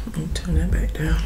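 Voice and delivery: unvoiced whisper; quick aside whispered. Real, not acted. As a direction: whisper it under your breath. Whispering while adjusting volume.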